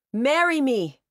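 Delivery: loud, high falling cry; victory salute, shouting the proposal high and loud; shouted. A narrator's reading. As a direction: make it a rallying call to the crowd. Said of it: "he shouted".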